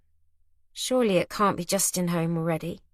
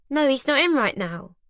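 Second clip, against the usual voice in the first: a lightly acted grumble, pitched higher; grumbling; 6 semitones higher than usual. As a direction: grudgingly answer the intruder. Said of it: "I grumble".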